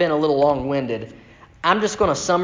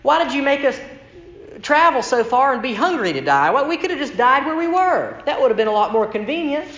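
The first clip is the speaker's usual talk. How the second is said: higher, complaining Israelite whine; whining Israelites; 9 semitones higher than usual. Retold or imitated. The grumbling people complain to Moses in a higher whine. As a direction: whine at Moses like you're doomed. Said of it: "In other words".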